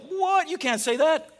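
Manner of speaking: high, shocked What? and a mock protest; outraged disbelief. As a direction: play the scandalised religious rulers. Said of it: "were just like".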